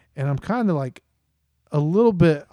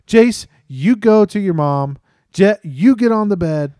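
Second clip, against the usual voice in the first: loud, raised, emphatic commands; urgent dad orders; 5 semitones higher, 7 dB louder than usual. Retold or imitated. Dad directs both boys before cleanup, urgent orders. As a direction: re-enact a dad taking firm charge.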